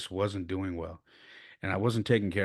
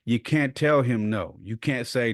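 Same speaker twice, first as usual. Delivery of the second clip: ordinary delivery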